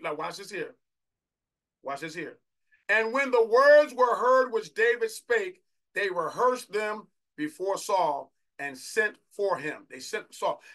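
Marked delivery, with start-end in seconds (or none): 2.9-9.9 loud, high declamation, slowly stretched; slow, full-voiced scripture proclamation; preached scripture reading. Real, not acted. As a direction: proclaim the verse to the whole congregation. Reads scripture at full force.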